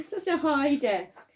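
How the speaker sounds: usual speaking voice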